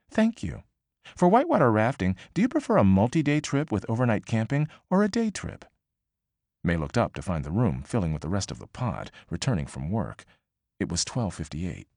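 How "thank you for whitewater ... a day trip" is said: newscaster voice. Acted. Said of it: "sounding like a newscaster's".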